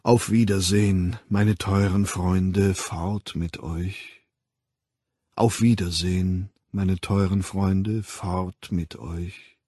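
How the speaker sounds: the voice bidding the dispersing crowd farewell; warm baritone farewell. Acted. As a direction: send them off gently, like a kind host. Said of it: "warm zugeneigten Bariton-Abschiedsworte".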